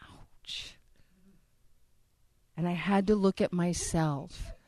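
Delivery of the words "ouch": a breathy stung whisper; whispered ouch. Real, not acted. Speaker stung by God's reply, whispered. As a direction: breathe it out, hurt.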